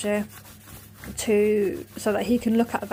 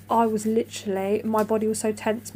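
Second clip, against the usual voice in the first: ordinary talk, nothing marked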